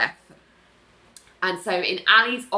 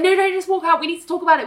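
pleading; 8 semitones higher, much faster than usual. Retold or imitated. Acted plea as Greg walks out.

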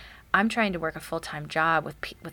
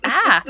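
sudden loud "ah" of pain from a hit on the nose; a sharp yelp, much louder, as if just smacked; 6 semitones higher, 12 dB louder than usual. Retold or imitated. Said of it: "and you're like".

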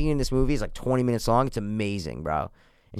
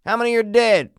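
calling down; 9 semitones higher, 4 dB louder than usual. Acted. Acted film quote, calling down.

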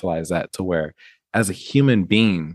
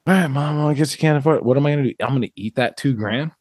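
pushover son; 6 semitones higher than usual. Retold or imitated. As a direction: give in to mom with a helpless sigh.

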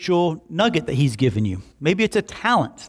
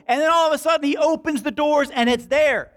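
full-force preaching; 11 semitones higher than usual. Acted. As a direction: preach the blessing to the rafters.

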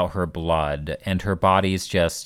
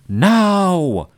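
high, loud hammed-up wail; mock screaming; 10 semitones higher, 5 dB louder than usual. Mocking the annoying character who's always screaming, hammed-up scream. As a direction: imitate an overdramatic character screaming in protest. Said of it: "the one that's gonna be screaming".

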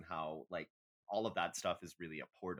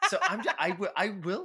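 loud, laughing stumble; trying to talk through laughter; 12 semitones higher, 10 dB louder than usual. Real, not acted. Cohost starts the Scorpio episode while laughing loudly.

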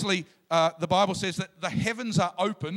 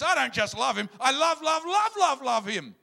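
rising higher and louder on each love; Father's gushing love; 7 semitones higher, 3 dB louder than usual. Retold or imitated. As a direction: build up the repeated love with delight. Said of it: "the Father, in my imagination, goes".

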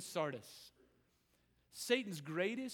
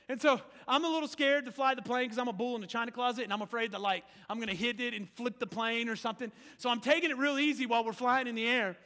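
ordinary delivery

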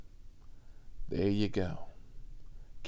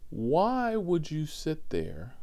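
normal talking voice

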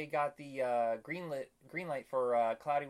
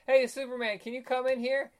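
higher, louder mock summons; calling someone over; 10 semitones higher, 7 dB louder than usual. Retelling the interviewer calling Superman in, raised calling voice. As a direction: call out brightly to another room.

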